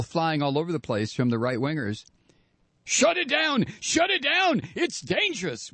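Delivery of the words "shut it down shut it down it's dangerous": high, hysterical mock screaming; mock shrieking. Host mimicking frothing right-wingers, high mock shriek. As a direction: imitate a shrieking mob, pitched way up. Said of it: "you heard the screaming and the shrieking".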